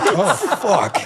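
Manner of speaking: high, laughing dismay. Retold or imitated. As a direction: squeak it out through a laugh. Caught out by recruiter.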